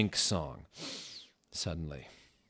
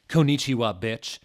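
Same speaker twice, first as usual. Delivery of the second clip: sassy greeting; 4 semitones higher, 8 dB louder than usual. Acted. Willow's greeting.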